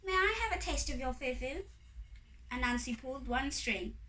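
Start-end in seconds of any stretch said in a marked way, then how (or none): 0.0-1.6 high, sweetly asking fairy; high-pitched courteous fairy; polite fairy request. A narrator's reading. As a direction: ask nicely in a high little voice. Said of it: "So the first fairy asked".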